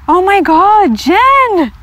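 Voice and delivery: gasp, then a high, loud "oh my God, Jen!"; gasp of delight. Real, not acted. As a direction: squeal with thrilled surprise. Recognises friend's gift.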